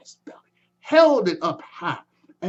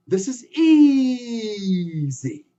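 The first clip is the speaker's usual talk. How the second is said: drawn-out strained "easy", very slow; straining effort; 3 semitones higher, much slower than usual. Acted. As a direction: squeeze out "easy" while hauling up. Anansi straining up tree.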